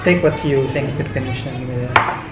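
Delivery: usual speaking voice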